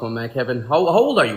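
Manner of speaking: ordinary talk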